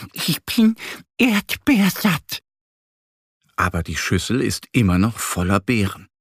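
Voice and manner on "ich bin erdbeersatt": much higher, slow stuffed duckling voice; little duck voice. A narrator's reading. Duck Annie holding her full belly, high squeaky voice. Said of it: "Annie hält sich ihren Bauch".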